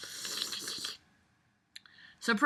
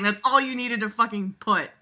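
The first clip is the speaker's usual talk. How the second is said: ordinary talk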